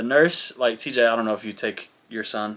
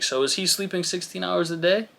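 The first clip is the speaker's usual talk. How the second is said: lightly imitated, higher rising question; questioning nurse; 6 semitones higher, 4 dB quieter than usual. Nurse asking dad about baby's sleep, lightly mimicked questioning. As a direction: lift the pitch into a polite query.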